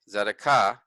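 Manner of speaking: tzedakah with the final "kah" stressed; stress on last syllable. Shown as a demonstration. Teacher reading the Hebrew word for righteousness, stress on last syllable. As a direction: land firmly on the end of tzedakah. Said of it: "put emphasis on the last syllable".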